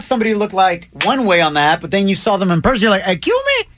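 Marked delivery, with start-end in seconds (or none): 3.1-3.7 high, incredulous double-take; pitched-up mock bewilderment; mock shocked disbelief. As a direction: squeak it out like you don't recognize them. Acted shock at date's looks.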